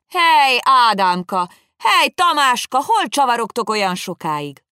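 loud shout. Acted. Old Pipitér hollering to the boys as a signal. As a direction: holler like calling lost friends. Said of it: "elkiáltottam magam".

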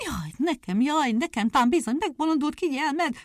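high, loud wailing lament; hedgehog's wife wails at her foolish husband. Acted. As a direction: wail over the lost bet. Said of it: "óbégatott az asszony".

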